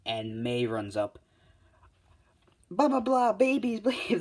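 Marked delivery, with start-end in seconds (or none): 2.7-4.2 very high, loud mock gushing; excited babbling. Mei running up to the team, mock excited babbling. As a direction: squeal it out as a hammed-up excited babble.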